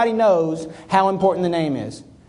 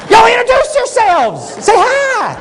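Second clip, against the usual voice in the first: mock eager host; 13 semitones higher, 5 dB louder, slower than usual. Acting out introducing people.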